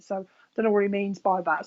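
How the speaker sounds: usual speaking voice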